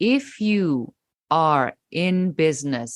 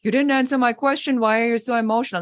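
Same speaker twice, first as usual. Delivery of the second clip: much higher, fast whiny mock man; mocking a sexist man; 8 semitones higher, faster than usual. A man baiting a woman at the table, mocking. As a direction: parody him as a whiny fool. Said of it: "Is he gonna say".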